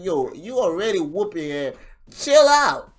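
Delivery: imitated yelling to chill; mock calming yell. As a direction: shout at him to back off. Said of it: "he's like".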